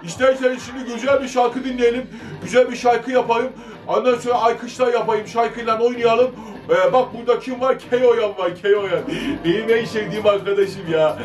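put-on cheeky orta oyunu jester talking to kids; İblis puppet voice. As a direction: play the mischievous İblis, chatty and playful. Plays İblis for children.

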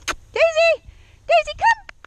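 very high, loud dog call; happy recall call. Shown as a demonstration. Trainer showing a happy recall, high loud call. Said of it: "She's gotta be happy about coming to me".

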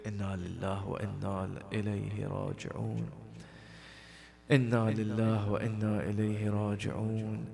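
soft, fast murmured prayer formula; quiet, fast Arabic prayer; closing prayer recitation. Real, not acted. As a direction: rattle the familiar closing prayer quietly and quickly.

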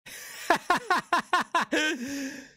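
loud laughing fit; loud high laugh. Real, not acted.

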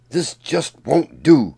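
loud, slow protest from the crowd; cried out. A narrator's reading. Townspeople crying out to be rid of Paul, loud. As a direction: call it out like an angry townsfolk. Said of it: "cried the townspeople".

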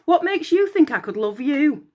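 sneering. Acted. Vain Narcissus mocks Echo.

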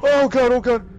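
high, loud frantic chanting; terrified screaming. Real, not acted. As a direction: scream it in pure terror. Monster chase, shouting.

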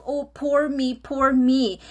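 hammed-up poor me; mock self-pity. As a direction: mimic your old self wallowing. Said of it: "I used to feel sorry for myself".

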